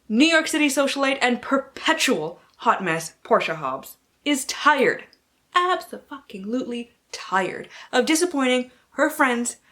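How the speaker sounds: lightly heightened blurb read; dramatic reading. Acted. Booktuber reading the Duke by Default blurb, dramatic. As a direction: read it like a movie trailer. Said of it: "I will be doing dramatic readings".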